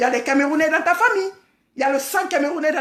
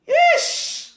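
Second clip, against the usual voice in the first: disgusted ish; 12 semitones higher than usual. Real, not acted. Disgusted jab at the rival.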